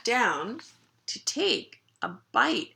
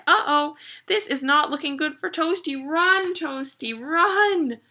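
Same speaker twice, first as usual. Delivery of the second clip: high, alarmed cheering-on; raised, excited narrator voice; urgent storytelling; 7 semitones higher, 5 dB louder than usual. A narrator's reading. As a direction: urge the hero to run, drawing out the last words. Narrator urges the chased dog.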